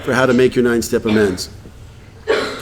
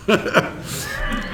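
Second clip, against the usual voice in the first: soft laugh; 6 dB quieter than usual. Real, not acted. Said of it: "laughs at own story".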